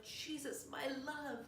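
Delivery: high, soft mock ecstasy; hammed-up boy in religious rapture; rapturous prayer. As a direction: pray it high and hushed, lovestruck. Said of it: "terrifying prayer where he's like".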